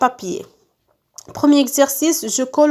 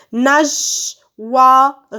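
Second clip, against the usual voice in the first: Nageoire pulled apart into separate beats; syllable by syllable; 3 dB louder than usual. Shown as a demonstration. Teacher cutting words into syllables, syllable by syllable. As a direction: sound out Nageoire as NA-GE, OI, RE. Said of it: "je découpe les mots en syllabes".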